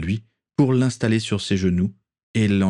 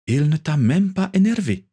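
higher, teasing female voice; lightly voiced Natalia; 8 semitones higher than usual. A narrator's reading. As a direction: tease your friend with a grin in the voice.

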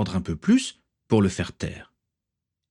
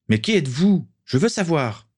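high, insistent demand; crying out; 8 semitones higher than usual. A narrator's reading. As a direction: exclaim it, pitched well up, demanding answers. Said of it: "s'écria John".

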